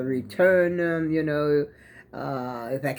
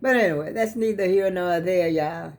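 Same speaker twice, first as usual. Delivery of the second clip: ordinary talk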